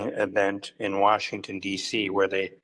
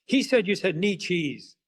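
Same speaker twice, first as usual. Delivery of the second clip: imitated accusation; 7 semitones higher than usual. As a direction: accuse them, playing the outraged colleague. Acts out Fabian's accusation.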